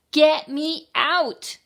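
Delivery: high, loud, slow yell; shouting. A narrator's reading. Child who couldn't stop wiggling while shopping, shouting. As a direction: holler each word with full stress. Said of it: "I shouted".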